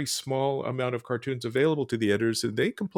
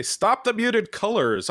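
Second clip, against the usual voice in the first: slower, higher, louder demanding editor; mock editor demand; 8 semitones higher, 5 dB louder, slower than usual. Editor complaining to a cartoonist, mock slower, higher, louder demand. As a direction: imitate a fed-up editor laying down the law. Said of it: "we sometimes get complaints from editors that say".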